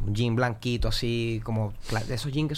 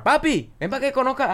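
high-pitched retold call to a friend; 13 semitones higher than usual. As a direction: imitate someone calling a buddy over. Acted call from Marc across the room. (llamado imitado, en voz aguda)

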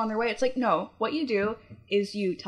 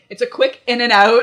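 brisk; 3 semitones higher, 10 dB louder than usual. Retold or imitated. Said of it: "she was just like".

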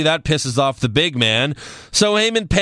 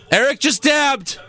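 high, drawn-out cry of amazement; excited exclamation; 8 semitones higher than usual. Pretend. Host crowing over his co-host's dab, high and excited. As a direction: crow it slowly, pitch shooting up. Repeats it as a mock announcement.

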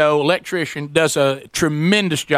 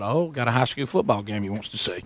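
soft, low sarcastic aside; sarcastic thought; 7 semitones lower, 7 dB quieter than usual. Retold or imitated. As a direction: mutter the sarcastic thought to yourself. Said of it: "And I thought".

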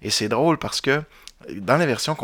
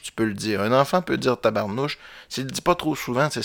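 normal talking voice

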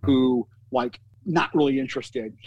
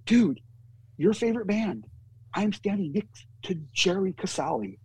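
pitched up, thrilled news to a friend; higher, buzzing with excitement; excited; 6 semitones higher than usual. Retold or imitated. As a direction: tell him like you can't believe it. Excitedly calling friend about Devo.

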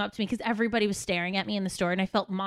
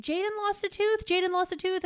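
higher-pitched mock questioning; imitating others; 8 semitones higher than usual. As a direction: lightly mimic everyone asking, pitched up. Quoting everyone asking about tooth.

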